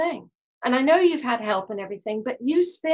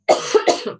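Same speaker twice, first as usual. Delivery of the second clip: harsh choking cough; coughing fit; 4 dB louder than usual. Real, not acted. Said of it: "now I'm choking".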